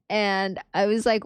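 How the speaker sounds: ordinary talk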